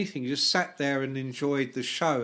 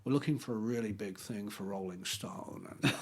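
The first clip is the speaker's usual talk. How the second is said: prank caller voice; 6 semitones lower, 9 dB quieter than usual. Retold or imitated. Prankster posing as Stones rep, low put-on voice. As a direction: play the smooth fake record man, lower. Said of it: "some made-up name like".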